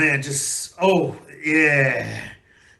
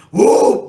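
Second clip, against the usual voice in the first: breathy celebratory woo; loud woo cheer; 9 dB louder than usual. Real, not acted. Fan on his vlog cheering, loud whoop.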